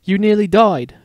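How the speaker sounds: loud, high exclamation; exclaiming warthog. A narrator's reading. The warthog exclaiming to the boy who just woke, loud. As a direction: burst out with it. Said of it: "the warthog exclaimed".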